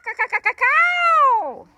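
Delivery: high, loud squawking call; parrot screech. Acted. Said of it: "began to screech".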